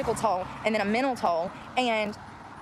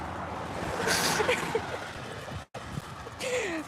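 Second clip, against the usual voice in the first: breathy giggle; 11 semitones higher, 5 dB quieter than usual. Real, not acted. Laughing at own bit.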